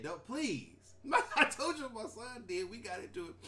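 normal talking voice

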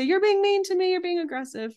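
hammed-up wounded partner complaining; mock whiny accusation. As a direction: play the hurt partner crying foul. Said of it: "Now they might say".

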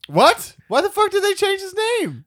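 high, loud, incredulous outburst; shocked outburst. Real, not acted. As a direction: blurt it out loudly in real disbelief. Shocked to learn the real name.

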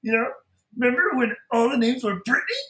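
high, strained, wailing voice with stretched-out words; Bobcat Goldthwait. Acted. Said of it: "he's got such a distinctive voice".

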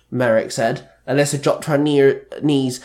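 plain reading voice

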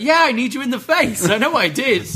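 hammed-up sulking wrestler; mock whiny Roddy. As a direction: squeal the accusation. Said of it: "He's like".

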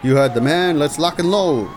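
gung-ho shout. Acted. Frank pumped for action, loud.

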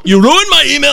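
high-pitched mock-outraged reading; all-caps read. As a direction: call it out like words in capitals. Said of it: "all caps".

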